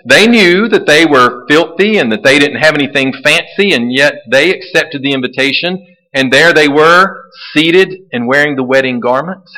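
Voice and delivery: usual speaking voice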